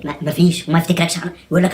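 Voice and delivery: ordinary delivery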